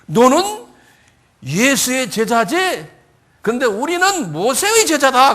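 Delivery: high, loud, drawn-out acted outrage; angry abuse. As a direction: hurl it as an indignant accusation. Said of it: "막 화가 나가지고 욕을 하는".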